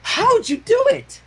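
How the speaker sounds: very high, slow eager question; eager Pinkie. Acted. Pinkie asking Twilight about the magic. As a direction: beg to know.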